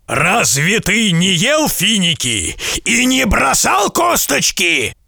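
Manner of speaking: high, slow menacing question; accusing giant. A narrator's reading. Giant questioning the merchant about date stones, slow menacing. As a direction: drag the question out as a threat.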